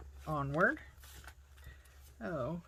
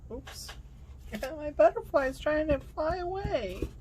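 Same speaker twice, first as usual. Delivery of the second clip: laughing, high and loud; amused exclamation; 10 semitones higher, 7 dB louder than usual. Real, not acted. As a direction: chuckle it out in surprise. Piece slipping, laughing.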